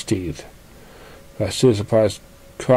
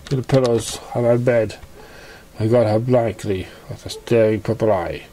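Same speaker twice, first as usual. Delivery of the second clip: usual speaking voice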